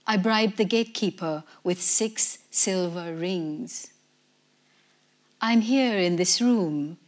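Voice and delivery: plain reading voice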